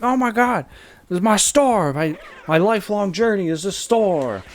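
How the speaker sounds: high, loud mock panic; freaking-out old man. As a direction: wail it as a distraught shopkeeper. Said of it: "the old man's freaking out".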